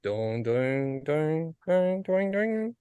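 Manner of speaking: mock tuning notes. Retelling how fast the guitarist tuned, rising mock notes. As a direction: plunk out the notes rising quickly.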